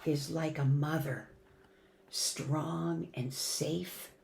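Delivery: tender reading. Acted. Mother passage, soft and slow.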